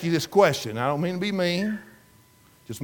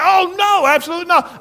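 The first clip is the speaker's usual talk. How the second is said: mock eager woman; 12 semitones higher, 6 dB louder than usual. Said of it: "She said".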